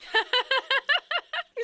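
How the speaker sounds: a gleeful little laugh, caught him; mischievous laugh. Acted. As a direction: giggle triumphantly at the prank. Laughing after pranking a friend.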